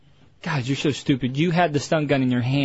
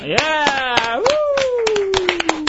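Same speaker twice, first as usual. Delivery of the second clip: excited cheer; 11 semitones higher than usual. Real, not acted. Prize winner congratulated on air, excited cheer. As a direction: whoop with delight, real.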